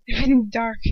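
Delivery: ordinary delivery, nothing marked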